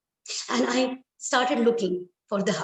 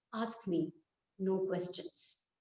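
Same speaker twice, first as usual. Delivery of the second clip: slow and much softer; cold quiet refusal; 3 semitones lower, 11 dB quieter, slower than usual. Acted. Husband quietly refuses his mother, slow and soft. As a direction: say it low, closing the matter.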